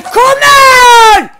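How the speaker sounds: drawn-out high "come on" yell; excited shout. Real, not acted. Player goading a rival in game, shouting. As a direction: bellow it, stretched out.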